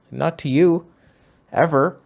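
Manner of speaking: emphatic rebuff. Real, not acted. Answering the photo's attention seeker, loud firm refusal. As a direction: shut him down for good. Snapping back at the photo subject.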